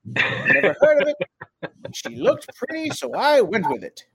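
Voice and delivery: hardboiled detective voiceover; noir detective. Pretend. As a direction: narrate it like a gumshoe. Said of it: "record yourself voicing right there".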